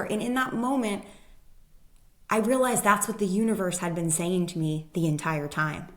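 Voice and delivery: ordinary talk, nothing marked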